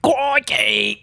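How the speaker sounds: high cartoon Australian exclamation; mock Australian accent. Interjecting on marrying an Australian, mock Australian accent.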